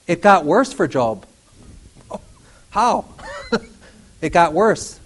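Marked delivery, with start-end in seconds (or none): none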